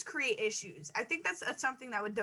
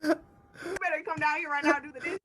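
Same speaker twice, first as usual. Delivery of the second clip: parent hollering up the stairs; yelling across the room; 4 semitones higher than usual. Pretend. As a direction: bellow the order across the house. Parent calling kid downstairs.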